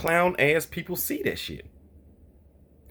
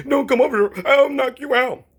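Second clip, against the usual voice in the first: mocking scared voice; 11 semitones higher, 5 dB louder than usual. Mocking a scared boxer in a high whiny voice. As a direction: squeak it out like a scared fighter. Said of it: "That's what bitches say".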